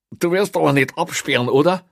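high, loud anxious question; worried prisoner. A narrator's reading. Prisoner afraid of being locked in, worried. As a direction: ask anxiously, pitched well up.

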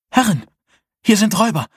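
breathless, high frightened gasp; gasping for air. Acted. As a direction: pant the words out in panic. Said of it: "keuchte sie".

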